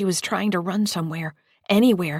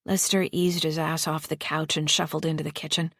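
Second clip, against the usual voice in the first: plain reading voice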